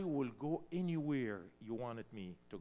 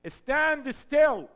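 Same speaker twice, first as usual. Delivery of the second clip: God's command thrown out high and loud, "still" held long; booming command; 12 semitones higher, 13 dB louder than usual. Retold or imitated. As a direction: proclaim "Stand still" as a loud divine order. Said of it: "God told Moses".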